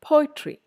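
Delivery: "poetry" with a high, loud PO; PO-etry, falling away after the start of "poetry"; first-syllable stress. Shown as a demonstration. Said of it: "first syllable".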